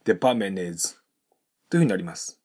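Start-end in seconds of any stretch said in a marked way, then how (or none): 0.0-1.0 "デパートメントis" as depamenez; linked without T. Shown as a demonstration. Teacher shows the word ending, "デパートメントis" with T dropped. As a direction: glue N to is in "デパートメントis", no T. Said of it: "Tが発音されずにNとisがくっついて".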